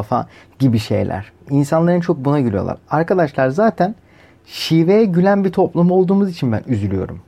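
ordinary delivery

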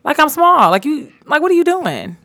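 high, exasperated retold scolding; irritated. Retelling her run-in with a coworker crowding her, irritated. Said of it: "I was just irritated".

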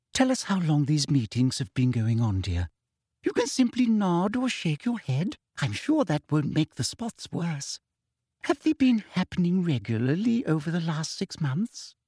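sugary, high-pitched toad-like sneer; prim, falsely sweet official; Umbridge voice. Acted.

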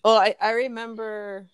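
normal talking voice

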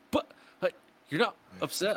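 high, puzzled question; mock surprised manager. Manager asking the DJ, surprised and puzzled. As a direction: sound baffled he isn't upset. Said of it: "He's like".

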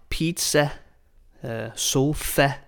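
"pizza" and "sofa" ending in an open eh; "pizza" as peed-seh, "sofa" as so-feh; exaggerated Danish ending. Shown as a demonstration. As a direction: overdo the final eh in "pizza" and "sofa". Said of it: "I'm exaggerating".

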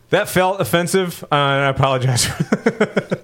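ordinary delivery

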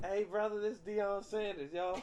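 Deion Sanders voice. Retold or imitated. Caller posing as Deion Sanders, hammed-up high voice. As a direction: puff it up like Deion Sanders on a prank call. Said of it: "This is how he talk".

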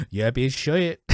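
mangled British vowels; shitty British accent. Shown as a demonstration. Riffing on bad accents with co-host, mangled British. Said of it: "like a shitty British accent".

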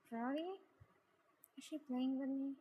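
ordinary delivery, nothing marked

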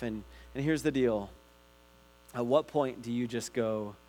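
usual speaking voice

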